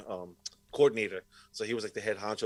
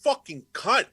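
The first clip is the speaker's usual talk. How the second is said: higher, louder retold furious insult; retold angry outburst; 10 semitones higher, 9 dB louder than usual. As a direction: spit it back at the teacher. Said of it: "and I got fucking mad".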